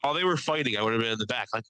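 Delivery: ordinary talk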